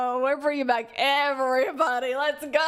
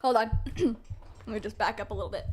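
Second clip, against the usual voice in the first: usual speaking voice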